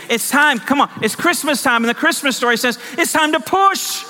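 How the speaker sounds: rousing pulpit exhortation, pitched high; impassioned preaching. Real, not acted. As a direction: preach it with rising urgency, stretching the last phrases. Preaching at full force.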